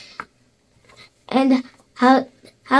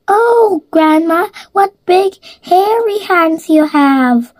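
put-on alarmed little girl; 6 semitones higher than usual.

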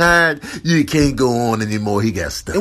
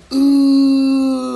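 high wailing cry; mock cry; 11 semitones higher, 5 dB louder than usual. Cat wailing as claws get clipped, a hammed-up cry. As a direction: let out a dramatic wail.

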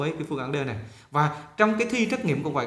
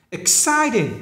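"Exciting" high on the second syllable, then falling; nhấn âm hai; 6 semitones higher, 4 dB louder, much slower than usual. Shown as a demonstration. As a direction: punch CIT in "Exciting". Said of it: "B là nhấn âm hai". ("Exciting" nhấn cao âm hai)

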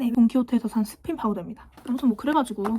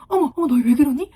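louder, shocked teacher's exclamation; retold teacherly alarm, louder; startled teacher; 3 semitones higher, 8 dB louder than usual. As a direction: imitate a flustered teacher walking in. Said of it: "선생님이 들어오면서".

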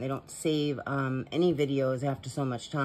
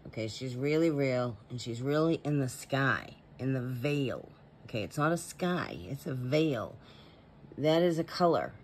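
ordinary talk

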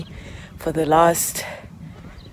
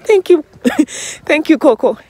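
bright high thank-you; 12 semitones higher, 5 dB louder than usual. Retold or imitated. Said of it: "I just said".

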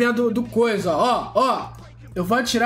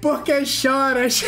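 slow taunt dissolving into laughs; gloating with a laugh. Real, not acted. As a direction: stretch the jeer out, then crack up.

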